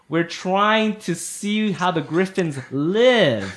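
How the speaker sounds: very slow, drawn-out, over-enunciated words; slowed, phonetic. Acted. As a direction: spell it out slowly for outsiders. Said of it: "his voice slow down and a little more phonetically".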